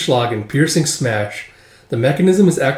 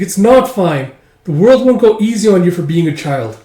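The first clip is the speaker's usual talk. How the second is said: higher, louder protest; upset child arguing; 5 semitones higher, 5 dB louder than usual. Acted. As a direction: argue back hotly as a child. Child argues back.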